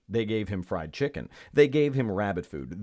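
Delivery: ordinary delivery, nothing marked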